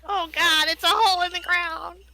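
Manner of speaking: high-pitched mock horror; mock dismay. Keen discovering a pre-plumbing outhouse, mock dismay. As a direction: wail it in hammed-up dismay.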